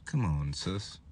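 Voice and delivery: ordinary talk, nothing marked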